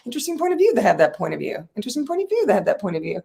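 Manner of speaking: a fast, repeated self-talk phrase; quick mantra. Shown as a demonstration. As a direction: rattle it off quickly. Demonstrates her repeated mantra.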